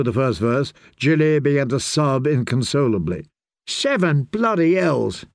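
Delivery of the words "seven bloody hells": a slow, higher irritated snap; snapped. A narrator's reading. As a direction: bite off the curse in annoyance. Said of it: "Darian snapped".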